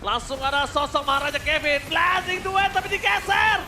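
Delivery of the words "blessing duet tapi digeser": fighting game announcer. Acted. Live esports play-by-play.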